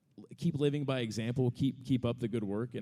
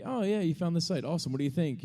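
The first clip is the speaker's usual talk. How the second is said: mock cheerful; 8 semitones higher, 4 dB louder than usual. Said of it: "I'm like".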